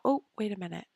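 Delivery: ordinary delivery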